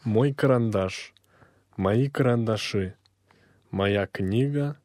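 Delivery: ordinary delivery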